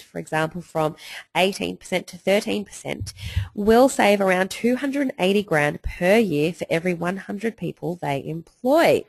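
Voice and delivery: usual speaking voice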